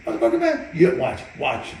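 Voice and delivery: high, loud defiance; defiant yell. Retold or imitated. Brother defying his trainer, high loud yell. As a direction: dare him to watch.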